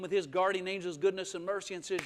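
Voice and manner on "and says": normal talking voice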